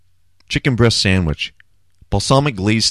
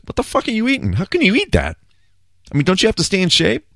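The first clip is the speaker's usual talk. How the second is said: much higher, incredulous mock Stern, faster at the end; mock Howard Stern; 11 semitones higher than usual. Stern grilling Rodman about his sandwich, in a high, incredulous mock voice. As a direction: play Stern gaping at a guy eating bacon sandwiches. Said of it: "He goes".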